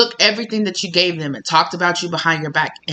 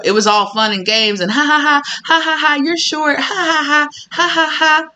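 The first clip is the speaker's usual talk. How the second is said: high mocking ha-ha taunt; 10 semitones higher than usual. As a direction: jeer like a schoolyard bully.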